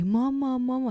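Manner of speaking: lightly voiced child's call for mama; toddler calling. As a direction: call out like a small child wanting mum. Toddler calling for mother, retold.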